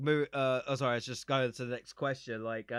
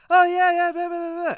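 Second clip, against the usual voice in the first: much higher and louder, chattering; high character voice; 10 dB louder than usual. Retold or imitated. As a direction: rattle it off in a high squeal. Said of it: "So then she's like".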